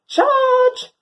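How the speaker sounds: loud, high charge yell; dog charging a seagull, battle cry. Acted.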